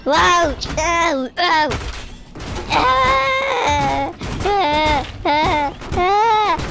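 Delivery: high, loud mock yelps of pain; ragdoll screaming. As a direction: yelp at every bump of the tumble, hammed-up. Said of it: "that's him screaming".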